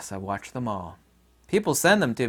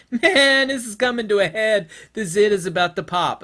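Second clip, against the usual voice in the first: high, loud hammed-up conspirator voice; gleeful insider spotting the crisis; mock schemer; 10 semitones higher, 8 dB louder than usual. Government insiders foreseeing the collapse, hammed-up scheming voice. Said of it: "It's like".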